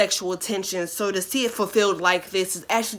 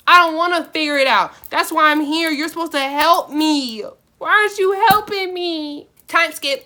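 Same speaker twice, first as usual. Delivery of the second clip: much higher and louder, fast whiny protest; mock outrage; 8 semitones higher, 8 dB louder than usual. Real, not acted. Scolding the fanfic writer for skipping, loud mock whine. Complaining the story skipped the scene.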